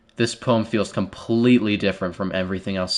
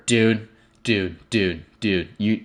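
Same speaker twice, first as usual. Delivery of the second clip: ordinary talk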